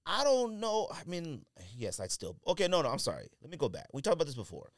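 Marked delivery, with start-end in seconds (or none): none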